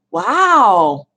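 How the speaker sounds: loud, swooping wow; amazed. Real, not acted. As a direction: let out an impressed exclamation. Admires a viewer's snake picture.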